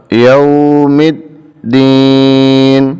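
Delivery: slow chant, یوم drawn long; Quranic recitation. Shown as a demonstration. Teacher recites the pausal form, slow chant. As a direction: recite slowly with tajweed. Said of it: "تو یہ بن جائے گا".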